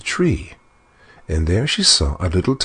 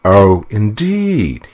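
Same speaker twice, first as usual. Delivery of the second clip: slow, drawn-out, disdainful; 4 semitones higher, 5 dB louder, much slower than usual. Acted. Dwarf brushing off the queen's offer, disdainful. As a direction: sniff at the offer, unimpressed. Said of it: "he said disdainfully".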